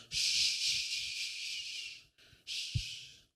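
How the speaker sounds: long, unvoiced hushing shh; soothing shush. Mother calming her crying baby, soothing shush. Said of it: "mientras más llora el, la mamá".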